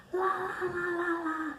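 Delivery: high sing-song drowning-out chant; retold yelling. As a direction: imitate someone yelling la-la to block talk. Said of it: "She starts yelling".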